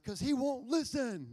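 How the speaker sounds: loud, high mock protest; re-enacted shouted complaint to mom; stubborn son yelling back. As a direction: yell it back defensively. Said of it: "And I was like".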